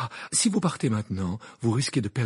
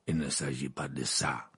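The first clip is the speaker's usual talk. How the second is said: growling Maugrey; 8 semitones lower, 5 dB quieter than usual. A narrator's reading. Old Auror cutting off the argument, growling. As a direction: growl it out, drawing the words out. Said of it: "grogna Maugrey".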